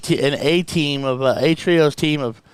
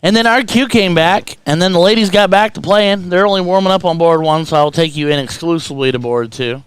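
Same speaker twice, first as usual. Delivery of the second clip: loud commentary, starting high and settling down; commentator hand-over; 4 semitones higher, 6 dB louder than usual. Acted. Host taking viewers over to board two, loud commentator voice. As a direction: project to the audience, easing down in pitch as you go.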